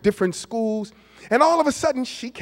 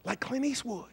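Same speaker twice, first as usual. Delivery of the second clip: ordinary talk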